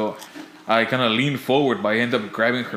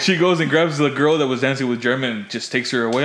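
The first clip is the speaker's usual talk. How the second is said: ordinary delivery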